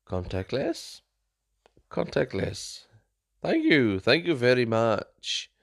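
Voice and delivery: English in a hammed-up French accent; imitation of a poor French accent; bad French accent. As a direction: mimic a tourist putting on a French accent. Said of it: "speak in a French accent in English".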